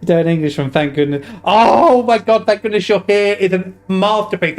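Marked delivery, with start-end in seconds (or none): 1.4-4.6 excited gushing. Real, not acted. Gushing over a favourite game.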